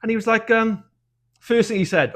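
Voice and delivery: ordinary delivery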